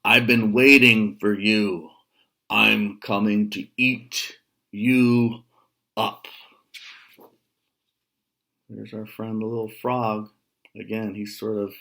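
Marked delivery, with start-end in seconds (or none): 0.0-6.3 menacing troll. Acted. Troll tells the big goat he'll eat him. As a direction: savour the threat.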